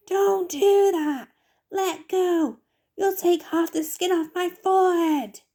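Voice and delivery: high, loud, slow screaming plea; panicked scream. Acted. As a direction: shriek at the wife to stop pulling. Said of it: "he screamed".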